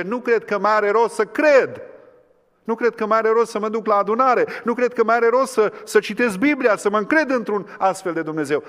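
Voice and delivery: loud, high cry of a doubter who gives up; anguished doubt; despairing doubter. Acted. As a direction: voice the hopeless believer at full force. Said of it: "unde stă și zice".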